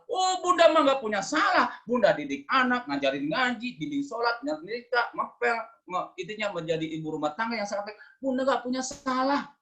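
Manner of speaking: high-pitched, indignant mock wife; self-righteous denial. Mother listing her chores to husband, self-righteous and high. Wife acted denying any fault.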